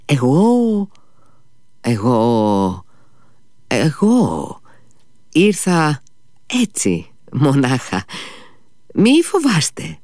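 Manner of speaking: high, halting near-shout, stumbling on each word; near shout, stammering. A narrator's reading. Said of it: "άρχισε να λέει δυνατά, σχεδόν φωνάζοντας, μα και κομπιάζοντας".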